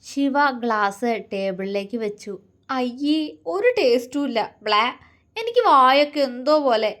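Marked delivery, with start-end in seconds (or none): 2.7-6.9 put-on higher, louder girlish grimace; pulling a face at the bitter drink; queasy disgust. A narrator's reading. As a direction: complain of a bad taste in the mouth. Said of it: "അവൾ വല്ലായ്മയോടെ പറഞ്ഞു".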